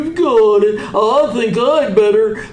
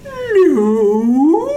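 ordinary talk, nothing marked

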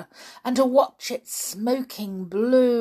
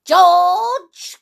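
put-on old woman calling from afar; loud calling grandma, high; from another room; 10 semitones higher, 10 dB louder than usual. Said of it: "came the awful voice from the next room".

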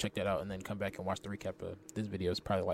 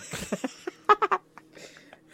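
loud, high burst of laughter; high-pitched cackling laugh; 14 dB louder than usual. Real, not acted. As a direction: crack up loudly. Cracking up at friend's accents.

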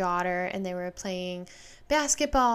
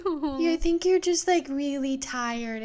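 soothing mom; 6 semitones higher, 5 dB louder, slower than usual. Retold or imitated. Mom voiced soothing child.

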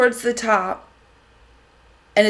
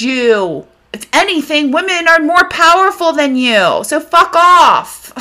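angry rant; 3 semitones higher, 3 dB louder than usual. Real, not acted. Angry rant at men who sexualize women.

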